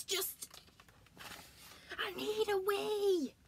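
high, slow, whimpering wail; desperate bear wail. Acted. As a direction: plead it, bursting.

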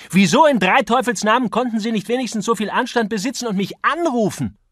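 very high, loud indignation; furious outburst. A narrator's reading. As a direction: rail at your opponent in outrage. Acted quarrel, outraged accusation.

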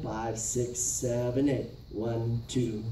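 ordinary talk

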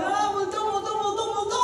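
screaming in fright. Acted. Preacher acts the terrified disciples, panicked screaming. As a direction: scream it like you saw a ghost. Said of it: "Umiiyak o nagsisigawan".

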